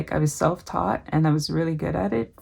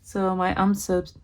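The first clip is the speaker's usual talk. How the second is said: usual speaking voice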